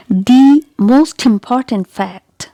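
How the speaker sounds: slow, with "the" stretched to stressed "thee"; emphatic the. Shown as a demonstration. Teacher shows emphasis on a fact, long stressed "thee". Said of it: "when you want to emphasize something".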